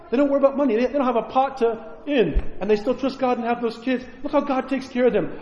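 a neighbor in awe of believers; admiring neighbor. Retold or imitated. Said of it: "and they'll say".

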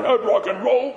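hammed-up parental disapproval; disapproving grandparent. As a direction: imitate an old-timer fussing. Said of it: "being like our grandparents and parents".

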